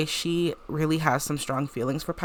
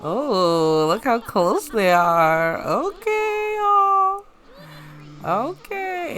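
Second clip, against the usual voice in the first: excited squeal; 7 semitones higher, 3 dB louder, much slower than usual. Real, not acted. Watching Sims slow dance closely.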